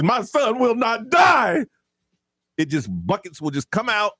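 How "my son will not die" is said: mock dramatic shout of a film hero; quoting John Q. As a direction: ham up the movie's desperate father. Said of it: "when he says".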